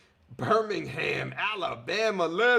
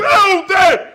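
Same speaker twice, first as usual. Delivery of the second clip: hype shout; 18 dB louder than usual. Real, not acted.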